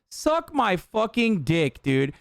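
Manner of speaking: ordinary talk, nothing marked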